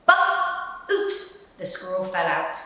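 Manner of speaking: ordinary delivery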